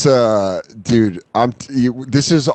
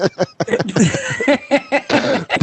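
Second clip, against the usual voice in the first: high laugh; 10 semitones higher, 3 dB quieter than usual. Real, not acted. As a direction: burst of high-pitched laughter. Laughs at the mock order.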